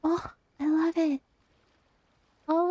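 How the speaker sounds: normal talking voice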